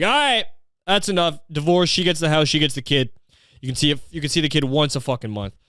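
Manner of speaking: mock judge cutting in, starting high and settling; fed-up judge. Judge cutting short a cringe divorce plea, fed-up ruling. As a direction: bang the gavel and rule at once. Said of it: "the judge just immediately".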